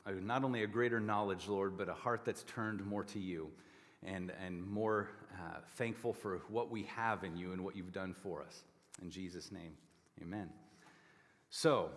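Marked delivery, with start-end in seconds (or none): 0.1-10.5 quiet, lower closing prayer. Acted. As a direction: pray softly to close. Closing prayer before the sermon.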